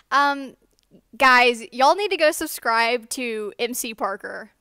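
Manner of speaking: loud, slow classmate announcing to the room; loud call-out. Retold or imitated. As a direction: call it out slowly across the classroom.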